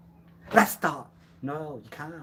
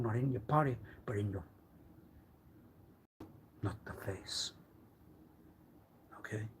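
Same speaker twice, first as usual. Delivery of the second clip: whispered threat, low and soft; menacing whisper; 3 semitones lower, 11 dB quieter than usual. Acted. Threatening to break bones, whispered menace. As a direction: hiss the threat close.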